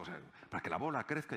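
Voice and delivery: normal talking voice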